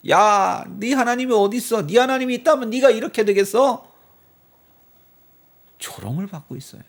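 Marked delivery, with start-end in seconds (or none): 0.1-3.9 high, loud sneering jeer; mocking taunt. People ridiculing David's God, high loud sneer. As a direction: scoff as if his God were a joke. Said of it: "조롱을 하는 거예요".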